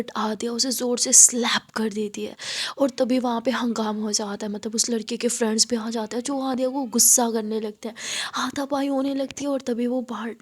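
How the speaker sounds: ordinary delivery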